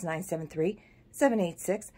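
ordinary talk

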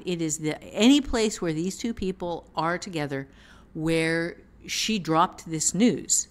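ordinary talk

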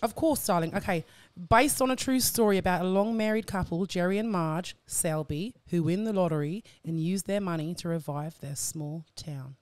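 reading in a put-on Australian accent; performed Aussie accent, slowing through the names. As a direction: read the synopsis as an Australian. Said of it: "in your, in your Australian accent".

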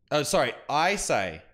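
ordinary talk, nothing marked

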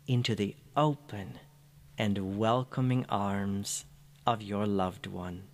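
ordinary delivery

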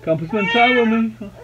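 voicing the baby. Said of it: "She's saying".

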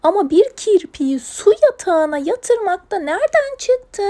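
high, loud incredulity; exasperated scolding. Acted. Owner questions putting hedgehog to bed, loud exasperation. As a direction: wonder aloud, exasperated and high-pitched. Leyla exasperated at animals' bedtime idea.

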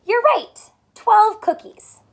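high, loud cheer of praise; excited praise. Acted. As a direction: celebrate the right answer. Praising child's answer.

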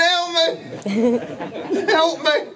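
high hammed-up cry of help, laughing through it; mock shouting for help. Mocking a drunk bandmate dragged from the bar, high mock yell. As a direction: yelp it like a drunk dragged off a stool. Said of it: "he's still shouting".

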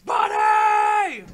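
high, long-held loud yell; imitated call-out. Quoting the buddy system call from a movie, imitated shout. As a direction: holler it out, stretching the last syllable.

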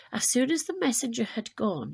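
plain reading voice

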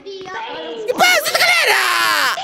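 long, high, stretched-out holler; drawn-out shout. Real, not acted. Gamer hollering at the game, drawn-out high shout. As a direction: draw each word out into a rising cry.

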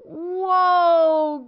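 loud high whoa as branch wobbles; wobbling whoa; groaning whoa. A narrator's reading. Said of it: "groaned Tortoise and Bird".

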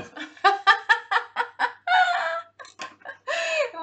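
loud, high, drawn-out laughing fit; uproarious laughter. Real, not acted. Streamer admits her English is gone, cracking up loudly.

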